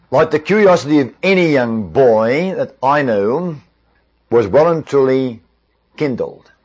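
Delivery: ordinary talk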